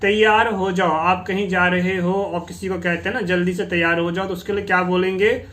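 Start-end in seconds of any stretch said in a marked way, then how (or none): none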